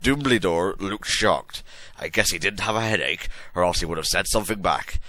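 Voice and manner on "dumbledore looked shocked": ordinary delivery, nothing marked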